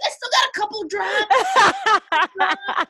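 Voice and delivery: teasing the son, cracking up; hammed-up laughing boast; laughing mock offer. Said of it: "I was like".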